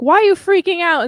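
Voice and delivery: high, loud, fast alarmed question; alarmed outcry. Retold or imitated. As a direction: call out at him, half-panicked and annoyed. Passengers alarmed at him.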